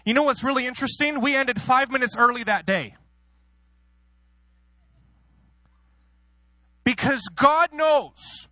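high, loud, soaring preacher's cry; preaching at full force. Acted. Preacher to congregation, declaring God knows, high and loud. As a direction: lift the room as you proclaim God knows.

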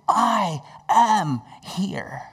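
"I am here" very slow, word by word; emphatic assurance. Retold or imitated. Jesus in the storm, emphatic slow "I am here". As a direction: draw out each word of "I am here". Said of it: "he had the emphasis".

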